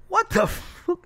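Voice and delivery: high, slow, drawn-out what the fuck; mock baffled outburst at a character holding a two-by-four; stunned disbelief. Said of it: "and I'm like".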